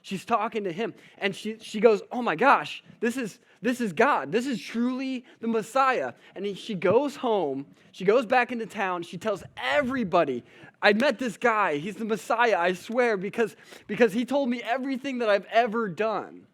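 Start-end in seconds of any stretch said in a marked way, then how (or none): 2.1-6.1 higher and louder in breathless wonder; awestruck excitement. Acted. Samaritan woman realizing she talks to the Messiah, excited and higher. 10.8-16.5 higher and louder, eager and insistent; excited testimony. Acted. Samaritan woman telling the town about Jesus, excited and louder.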